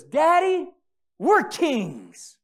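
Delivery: put-on high, loud five-year-old proclamation; imitating boy's shout. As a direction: call out like an excited little boy. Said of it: "he just shouts".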